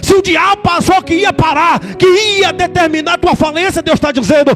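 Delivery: pastor's rousing sermon voice climbing to shouts; fiery preaching. Acted. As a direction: preach it, rising till you shout the final lines. Preaching at full force.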